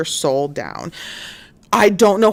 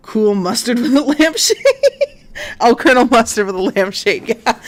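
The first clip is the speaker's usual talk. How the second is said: pitched-up words breaking into laughter; laughing at own joke; 5 semitones higher, 3 dB louder than usual. Real, not acted. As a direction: crack up mid-joke.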